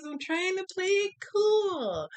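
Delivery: high strained squeal on "I'm trying to play it cool"; mock frantic squeak. High squeal, telling viewers she's trying to play it cool. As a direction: squeak it out, pretending to be calm.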